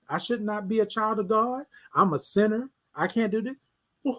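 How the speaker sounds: normal talking voice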